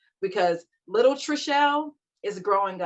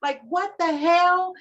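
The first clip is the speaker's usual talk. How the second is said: high-pitched exasperated complaint; retold screaming frustration; 8 semitones higher than usual. Retelling her old rants about healing alone, high exasperated whine. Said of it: "days where I would scream".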